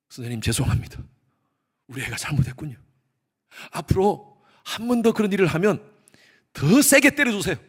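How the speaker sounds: imitating old-time parent. 옛날 부모가 교사에게 전화.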